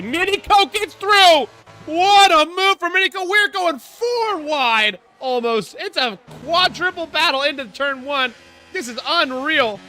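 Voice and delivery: high, loud, thrilled commentator call; excited shout. Real, not acted. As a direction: yell it out as the pass happens. Racing commentator erupts at overtake.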